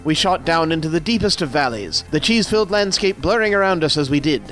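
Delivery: lively storyteller reading aloud; story narration. A narrator's reading. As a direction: read it like a grand tall tale. Reads comic story aloud.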